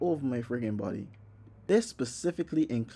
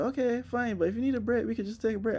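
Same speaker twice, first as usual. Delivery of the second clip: high gentle Sarah; 8 semitones higher, faster than usual. Retold or imitated. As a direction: offer a break sweetly. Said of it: "she was like".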